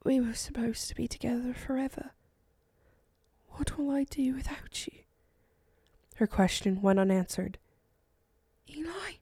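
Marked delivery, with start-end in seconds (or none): 0.0-4.9 soft, higher whisper of grief; barely audible whisper. A narrator's reading. Eleonora at her dying husband's side, whispering. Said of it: "The whisper ... was barely audible". 8.7-9.1 tiny, much softer, higher question; faint call. A narrator's reading. Eleonora softly checking on Eli, very faint and high. Wife calls to fading husband.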